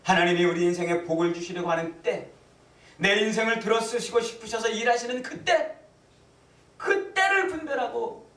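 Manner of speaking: proclamation rising to a high cry; fervent preaching. Real, not acted. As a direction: build urgently toward the key moment. Preaching at full force. (점점 고조되어 높게 외치는 설교)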